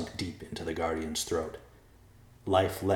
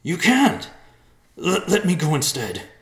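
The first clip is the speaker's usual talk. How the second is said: high, loud, stammering plea; desperate cry; 11 semitones higher, 10 dB louder than usual. A narrator's reading. Riverpaw begs to take his father's place, crying out. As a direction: plead loudly, stumbling over the words. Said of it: "Riverpaw cried".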